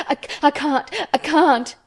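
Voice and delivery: high, broken, stammered gasps; gasping. A narrator's reading. Hysterical boy insists he cannot stop, gasping. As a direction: catch for air before each try. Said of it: "he gasped and stopped".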